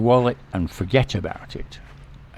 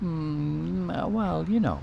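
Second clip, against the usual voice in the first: hesitant shrug; 8 semitones higher, 4 dB quieter, slower than usual. Retold or imitated. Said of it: "a very similar response".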